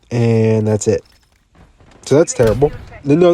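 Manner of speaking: usual speaking voice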